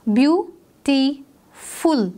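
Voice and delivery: "ब्यूटीफुल" slowly as beau, ti, ful; split into syllables. Shown as a demonstration. Teacher demonstrating breaks in the voice, syllable by syllable. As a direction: pause after each of the three parts of "ब्यूटीफुल". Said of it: "three times हमने लिया break".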